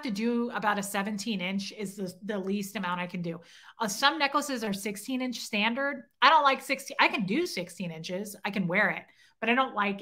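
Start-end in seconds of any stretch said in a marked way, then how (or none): none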